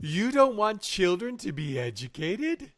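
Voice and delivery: high, loud pretend shock; mock incredulous. Feigned shock at co-host's remark.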